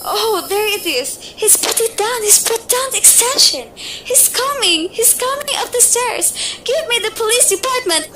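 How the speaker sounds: building from frightened gasps to a sobbing cry for help; mounting terror. Acted. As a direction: she hears him on the stairs and breaks. Killer coming up the stairs.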